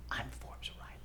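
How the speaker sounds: a whispered mock-grand name drop; whispered boast. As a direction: hush it like a secret brag. Retold boast to partner.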